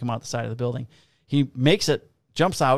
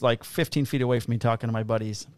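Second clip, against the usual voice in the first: usual speaking voice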